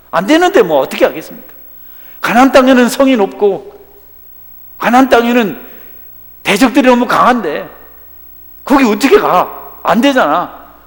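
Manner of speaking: loud, high whining complaint; negative complainer. Acted. Preacher mimicking a doubter, loud and whining. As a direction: protest that it can't be done. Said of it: "부정적이니까".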